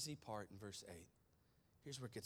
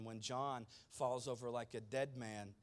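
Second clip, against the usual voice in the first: normal talking voice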